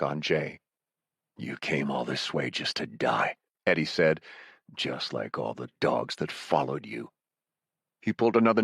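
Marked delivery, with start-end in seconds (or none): 1.4-3.4 menacing villain. Acted. Villain Eddie taunts Raven. 4.7-7.1 low, soft, menacing villain voice. Acted. Villain Eddie taunts Raven.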